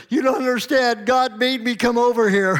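a grown son breaking, tears in his eyes; tearful outburst. Retold or imitated. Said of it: "tear in his eyes".

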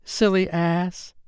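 high, slow taunt without volume; shouting fairy. A narrator's reading. Fairy jeering at Tootles before hiding, high slow shout. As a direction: jeer it high and drawn out. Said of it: "Tinker Bell shouted".

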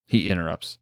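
plain reading voice

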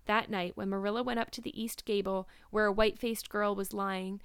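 usual speaking voice

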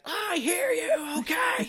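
high, slow, crying shout; yelling at the door while guests stare; frantic yell. Retold or imitated. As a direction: scream it at the banging door. Throws glass, yells at door.

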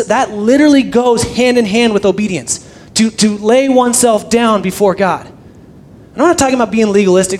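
ordinary talk

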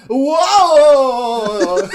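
loud high startled laugh; surprised shout. Real, not acted. Chokehold surprise.

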